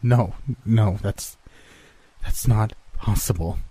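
usual speaking voice

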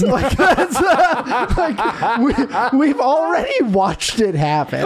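talking through laughter; words broken by real laughs; amused rant that keeps cracking up; laughing. Real, not acted. Laughing at the absurd plot.

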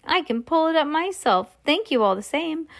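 put-on high-pitched, unbothered old grandmother; casual and innocent, pitched well up; cool, unruffled grandmother. A narrator's reading. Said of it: "the coolness of her voice".

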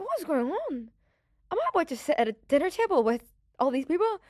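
high-pitched retold disbelief; hammed-up stunned questioning; incredulous. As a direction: act out bewildered astonishment, pitch lifted. Acts out disbelief on entering room.

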